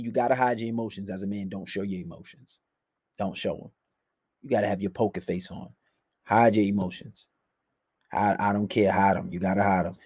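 very low, level murmur; poker-faced. Shown as a demonstration. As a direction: keep it deep, soft and emotionless. Models keeping emotions hidden.

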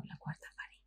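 very soft, unvoiced-breath question; baffled whisper. Actress privately puzzled by the director's jargon, whispered. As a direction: whisper it to yourself, clueless. Retelling private confusion on set.

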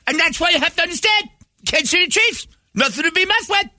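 piercing, very high-pitched radio rant; Mad Dog. Retold or imitated. Radio host ranting over the convention floor, piercing impression. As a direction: imitate a screaming sports host at piercing pitch. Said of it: "wow, that is piercing".

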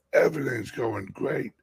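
normal talking voice

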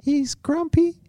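put-on piping falsetto girlfriend; high-pitched girlfriend. Playing own girlfriend complaining about the boyfriend, high falsetto. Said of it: "My girlfriend's got quite a high-pitched voice".

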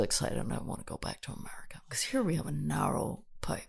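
hushed whisper. Pretend. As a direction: whisper it like a secret. Mock-secret about pipes.

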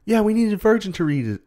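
high-pitched quote; retold character voice. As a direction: play it up high, imitating. Retold exchange over the spell.

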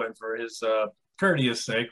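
usual speaking voice

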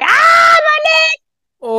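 high, loud yelp of pain, replayed; retold cry of pain. Stuck in the challenge ball, yelling out in pain. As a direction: cry out as if your leg is caught. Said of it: "I've got a very loud voice".

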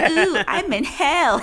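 high, slow, drawn-out mocking demon; hammy demon voice. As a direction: camp it up as a gleeful demon. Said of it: "He's like".

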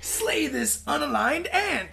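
slow, high, portentous order; imperious command. Retold or imitated. Ordering the hero to slay, slow mock-epic command.

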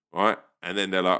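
normal talking voice

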